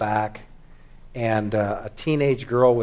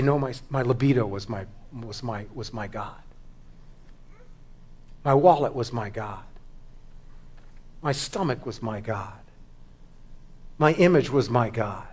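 hushed confession; 6 dB quieter than usual. Acted. Sinner answering God at judgment, hushed confession.

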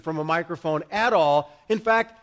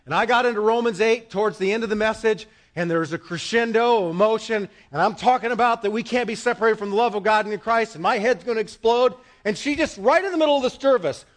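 ordinary talk

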